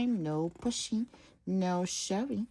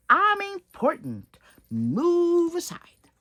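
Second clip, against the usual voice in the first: loud high haughty order; pompous limo; 11 semitones higher, 7 dB louder than usual. Acted. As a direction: demand room slowly and proudly. Proud limo bossing traffic.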